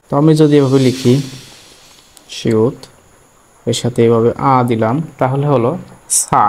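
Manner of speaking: usual speaking voice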